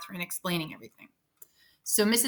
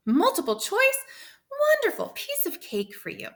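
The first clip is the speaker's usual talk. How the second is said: much higher, excited surprise; light up at the good news; a thrilled coach reassuring her student; delighted; 13 semitones higher than usual. A narrator's reading. Mrs V delighted.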